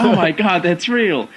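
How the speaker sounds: imitating a stunned guest; startled gasp. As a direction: react like the axe just proved real. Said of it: "they're just like".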